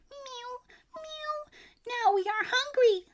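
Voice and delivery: little cats whining for food; hungry cats. A narrator's reading. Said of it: "said the hundreds of cats".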